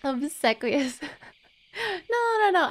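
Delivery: normal talking voice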